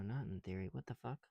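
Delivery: ordinary talk, nothing marked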